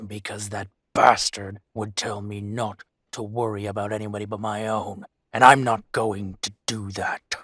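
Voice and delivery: loud furious rant, climbing to a shout; angry outburst. Acted. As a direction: let the anger break loose on Alistair. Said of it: "This whole thing has me on edge".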